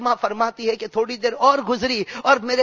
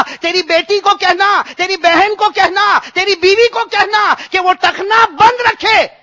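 loud, high preaching rebuke; fervent scolding sermon; 7 semitones higher, 8 dB louder than usual. Acted. Preacher rebuking the congregation about dress, preaching at full force. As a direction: drive the reproach home to the crowd.

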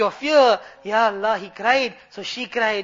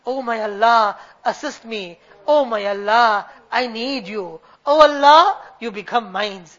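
pleading to Allah; 6 dB louder than usual. Acted. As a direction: cry out the name, then confess humbly. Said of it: "he is pleading to you".

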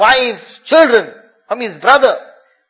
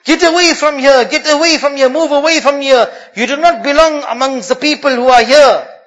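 a raised voice calling out a warning; stern, raised warning cry; loud announcement; 5 semitones higher, 5 dB louder than usual. Acted. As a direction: order them away from the fire. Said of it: "suddenly somebody announces".

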